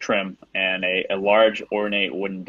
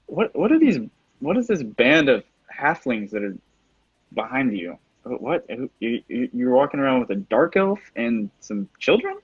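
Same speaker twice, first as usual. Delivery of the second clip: high, stammering mock puzzlement; haughty villain, pitch lifted in disbelief; incredulous villain; 4 semitones higher than usual. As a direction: scoff at the odd band in front of you. Game master voices the villain Miros.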